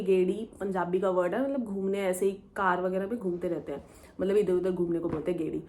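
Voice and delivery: normal talking voice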